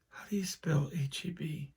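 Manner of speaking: put-on whispered, puzzled kid; mock whisper. Spelling-bee girl whispering her confused question. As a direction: whisper it as if stumped.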